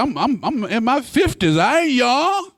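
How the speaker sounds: high, squeaky protest; indignant son. Retold or imitated. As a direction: bristle at being called young. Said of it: "I said".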